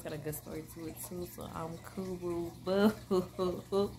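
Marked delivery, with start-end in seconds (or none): none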